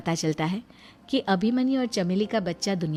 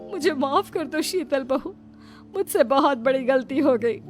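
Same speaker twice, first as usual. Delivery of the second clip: higher, pleading apology; remorseful mother-in-law; 6 semitones higher, 4 dB louder than usual. Acted. Mother-in-law begging Sheetal's forgiveness, remorseful. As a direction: beg forgiveness, building in force.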